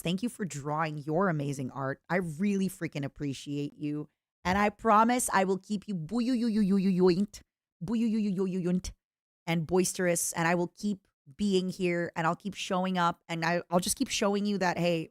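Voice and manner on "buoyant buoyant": booyooyoyoyoyoyoint warbled and drawn out slowly; goofy warbled buoyant; silly stretched buoyant. Shown as a demonstration. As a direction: play with the word buoyant, wobbling the oy. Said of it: "You keep us buoyant".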